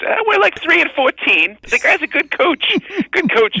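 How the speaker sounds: high, loud hammed-up Mad Dog impression; mock sports-radio whine; imitating Mad Dog. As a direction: pitch it up and insist like a defensive dad. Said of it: "And he goes".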